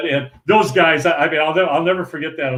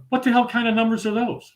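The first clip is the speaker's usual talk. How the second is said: higher-pitched, incredulous question; retold incredulity; 8 semitones higher than usual. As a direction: imitate a baffled friend questioning the choice. Puzzled friend mocks his picks.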